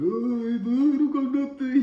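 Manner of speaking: high, loud, slow, terrified whimper of a voice; a frightened man's voice cracking way up high; scared squeak. Retold or imitated. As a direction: play the homeboy squeaking in pure fear. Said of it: "out of fucking pure fear now".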